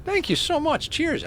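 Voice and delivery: high-pitched thank you; excited thanks. Real, not acted. Streamer thanking Admiral, high and excited.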